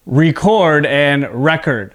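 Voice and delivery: record said slowly as ree-CORD, then record as REK-erd; slow contrast, verb record then noun record; contrasting stress. Shown as a demonstration. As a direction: stress the end of the first record and the start of the second record. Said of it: "let's focus on the verb first".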